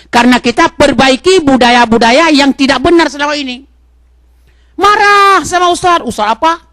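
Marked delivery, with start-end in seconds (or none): 4.8-6.6 mock angry congregant. Preacher mimicking angry congregation.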